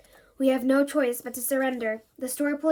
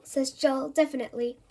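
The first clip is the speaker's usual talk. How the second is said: ordinary delivery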